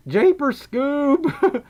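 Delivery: high, slow, loud cartoon exclamation; cartoon catchphrase. Streamer blurts out a playful catchphrase, high cartoon voice. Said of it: "also Southern accent".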